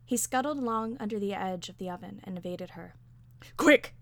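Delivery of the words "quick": high, loud shout; frantic one-word yell; urgent order. A narrator's reading. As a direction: fire it out as a command. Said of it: "she ordered".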